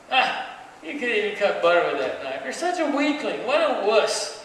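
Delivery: insulting. Acted. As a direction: heap scorn on him to provoke a fight. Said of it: "He just kept insulting him".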